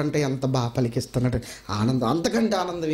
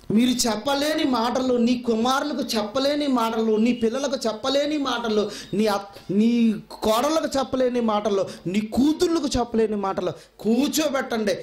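fervent preaching; 6 semitones higher than usual. Acted. Preacher's rising rhetorical list.

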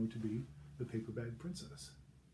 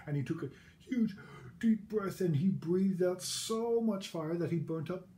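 dramatic build; 7 semitones higher, 7 dB louder than usual. A narrator's reading. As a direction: puff it up like a giant breath of fire. Narrating the huge fiery breath.

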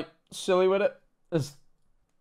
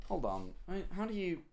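normal talking voice

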